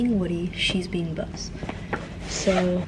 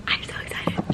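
whispering; 8 dB quieter than usual. Shown as a demonstration. Whispered repeat after normal take.

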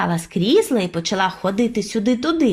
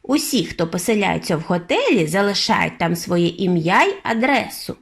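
plain reading voice

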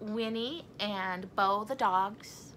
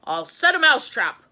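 louder, higher, emphatic declaration; raised voice, mind made up; Mister Scott, resolved; 6 semitones higher, 12 dB louder than usual. A narrator's reading. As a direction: announce it with loud determination. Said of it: "Mister Scott said".

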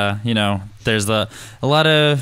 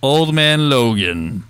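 dramatic trailer voice; 4 semitones higher, 4 dB louder, slower than usual. Acted. Dramatic naming of comic title.

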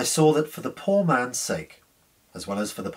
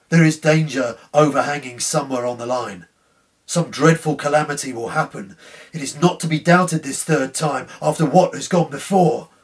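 a lightly voiced fearful signalman, louder; a touch of haunted panic, louder; anxious dread; 3 semitones higher, 6 dB louder than usual. A narrator's reading. Signalman fears coming calamity.

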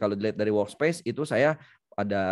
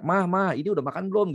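raised, high-pitched mock call to mum; retold calling out; 8 semitones higher, faster than usual. Son calling mum over the home IP camera, retold calling out. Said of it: "langsung teriak".

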